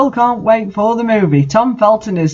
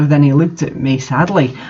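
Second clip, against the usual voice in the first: ordinary delivery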